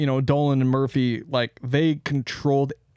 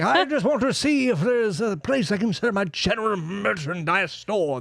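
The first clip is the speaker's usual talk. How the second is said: higher, hammed-up Brit with Aussie creep; British accent turning Australian; 6 semitones higher than usual. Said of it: "The Australian is really creeping up".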